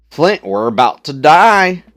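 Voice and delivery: loud, slow Southern panic; panicked country accent. Acted. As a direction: yell it like a doomed trucker. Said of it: "they just sound very country, southern".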